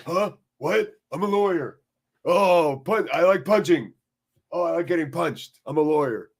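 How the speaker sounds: a hammed-up, half-serious superhero lawyer; Daredevil impersonation. Streamer doing Daredevil for chat, lightly mock-heroic. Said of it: "Daredevil impersonation".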